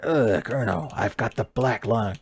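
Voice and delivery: a hint of Solid Snake complaining; mild Snake grumble to the Colonel; bad Solid Snake impression. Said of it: "a bad Solid Snake impression".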